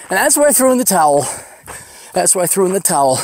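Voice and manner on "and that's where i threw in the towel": higher and louder, fed-up punchline; exasperated outburst. Real, not acted. Hiker telling listeners how he gave up, exasperated outburst. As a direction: throw your hands up as you say it.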